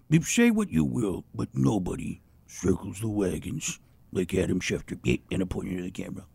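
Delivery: booming-style sportscaster, kept low; Chris Berman voice. Acted. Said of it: "I just started going Berman".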